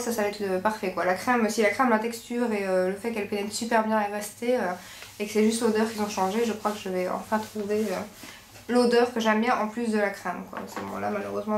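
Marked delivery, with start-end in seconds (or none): none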